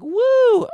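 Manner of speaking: loud, high-pitched woo; mock crowd cheer. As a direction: imitate a few girls whooping. Imitating ten girls cheering.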